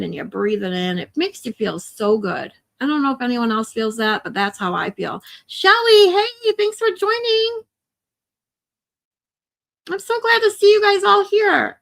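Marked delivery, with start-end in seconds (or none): 5.5-11.8 high, loud, beaming welcome to a friend arriving; excited greeting. Real, not acted. Streamer greets viewer Shelly joining live, loud and bright. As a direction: call out her name with delight.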